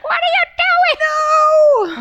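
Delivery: very high, long drawn-out holler; imitated frantic wail. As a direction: mimic yelling after a runaway duckling. Imitating chase after runaway duckling.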